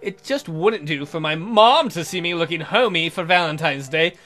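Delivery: ordinary delivery, nothing marked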